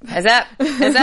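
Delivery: light up at the name; excited. Real, not acted. Excited repeat of game name.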